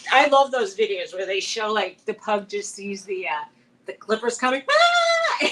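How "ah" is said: very high, loud mock shriek; imitated pug scream. Imitating pugs who see the clippers coming, mock scream. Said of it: "They start screaming like that".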